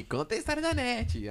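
high-pitched mock demand; badgering fans, imitated up high; imitating pestering fans. Voicing pestering fans' demand.

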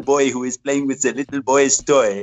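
playful mock French accent, high-pitched. Host teasing a pilot about his new toy, French accent. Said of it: "stupid French accent".

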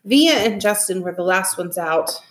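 plain reading voice

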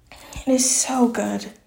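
whispered, slow and hushed; slow Southern hushed whisper of delight; excited whisper. Real, not acted. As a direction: breathe it out like a secret treat. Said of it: "I'm so excited".

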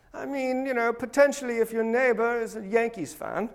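high, soft British hedging; mock British accent. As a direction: hedge in a put-on British voice. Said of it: "Marx wasn't British, by the way".

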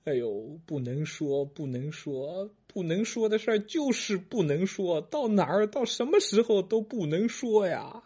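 put-on a slapped old man whimpering his lesson; weepy grandpa lament. Grandpa wailing to his grandkids, high and slow, that some things can't be said.

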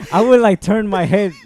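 high, loud, laughing through the words. Real, not acted. Student telling friends about getting caught copying, laughing. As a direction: crack up while telling it. Cracks up while telling story.

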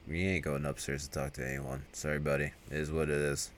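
low, very soft and offhand; mock cold farewell. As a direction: imitate a killer quietly dismissing his victim. Killer to dying partner, acted.

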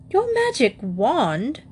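high, slow questioning repeat; astonished father's question, read in character; incredulous echo. A narrator's reading. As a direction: echo it back in disbelief, stretched out. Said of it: "echoed Mister Brown".

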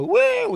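shrieked high woo, arms up; mock party woo. Comic imitating a heckler's piercing woo. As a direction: imitate the drunk lawyer's woo. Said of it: "while people go".

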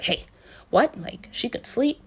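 mock astonished double-take; retold surprise. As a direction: react as if imitating someone caught off guard. Retold reaction to startling news.

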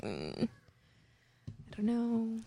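slow, soft, unsure mumble; hesitant. Retold or imitated. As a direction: trail off doubtfully. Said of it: "you're kind of like".

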